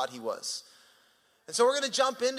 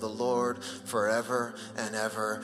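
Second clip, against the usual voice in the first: scripture reading; 4 semitones lower than usual. Acted. Resumes reading the psalm.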